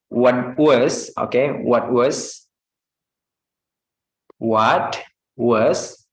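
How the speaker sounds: "what was" with the T flapped into the W; connected speech demo; linked what was. Shown as a demonstration. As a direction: blend "what was" smoothly. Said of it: "Seperti example".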